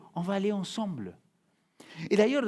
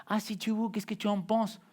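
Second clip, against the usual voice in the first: ton désinvolte imité; 6 semitones higher, 4 dB quieter than usual. Retold or imitated. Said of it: "C'est pas".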